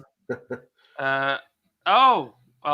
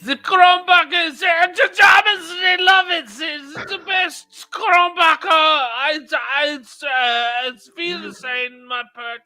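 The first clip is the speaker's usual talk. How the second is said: shouted German ad; 9 semitones higher, 6 dB louder than usual. Acted. Hammed-up German beer pitch.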